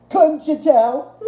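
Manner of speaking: high, slow, campy put-on voice; playful high camp drawl. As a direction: ham up a flamboyant camp voice. Camp act in reply to chat.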